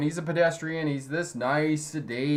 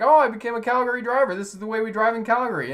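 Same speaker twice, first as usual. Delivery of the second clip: lightly voiced girlfriend, high and fast; a hint of a proud Calgary driver; girlfriend quote; 8 semitones higher, 4 dB louder, faster than usual. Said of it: "she's like".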